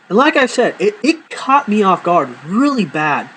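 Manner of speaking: ordinary talk, nothing marked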